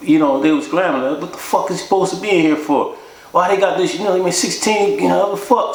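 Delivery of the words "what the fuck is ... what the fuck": outraged inmates. Retold or imitated.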